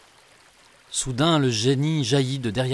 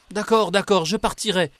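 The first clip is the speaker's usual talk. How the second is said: higher, louder frightened giving in; trembling voice; 9 semitones higher, 5 dB louder than usual. A narrator's reading. Genie promising the beggar to leave, trembling. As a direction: cave in quickly, scared. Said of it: "d'une voix tremblante".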